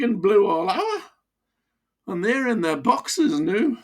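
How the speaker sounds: put-on old Geordie-style miner joking grimly; broad Wearside accent. Old miner back to his Wearside voice. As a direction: back into thick Wearside, chuckling. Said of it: "He slipped back into deepest wearside".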